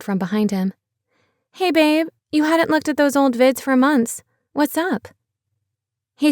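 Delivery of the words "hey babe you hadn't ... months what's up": higher, lighter woman's voice; casual teasing wife checking in; playful girlfriend peeking in; Becky's voice. Acted. Becky greets Pete, voiced by narrator.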